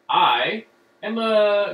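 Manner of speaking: ordinary talk, nothing marked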